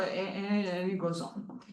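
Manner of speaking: usual speaking voice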